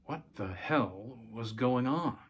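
usual speaking voice

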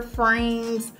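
ordinary talk

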